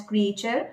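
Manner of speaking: "creature" as three syllables, kree-AY-cher; students' mispronunciation. Retold or imitated. Coach quoting her students, students' mispronunciation. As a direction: imitate learners saying "creature" as kree-ay-cher. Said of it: "Even my students pronounce it as".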